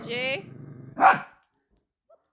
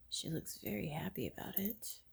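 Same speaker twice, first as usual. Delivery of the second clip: soft whispered aside; 10 dB quieter than usual. Real, not acted. Owner whispering to the audience about the happy dog. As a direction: hush it so the dog stays calm.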